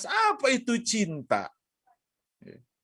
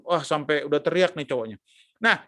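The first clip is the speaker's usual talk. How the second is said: normal talking voice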